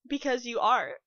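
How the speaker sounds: loud retort from the customer; snapping customer. Retold or imitated. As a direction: throw it back at her loudly. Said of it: "And then she goes".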